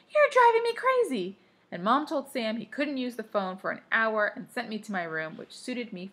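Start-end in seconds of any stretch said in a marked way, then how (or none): 0.1-1.4 very loud, high mimicking yell; shouted back. A narrator's reading. Brother shouting back at his sister, louder shout. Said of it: "And Sam shouted".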